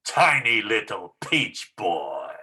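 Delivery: put-on slow, taunting peach boy line; slow mocking demo. Shown as a demonstration. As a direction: sneer it out slowly. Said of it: "With open hands like this".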